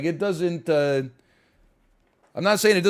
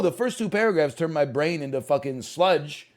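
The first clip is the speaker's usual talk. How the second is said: ordinary delivery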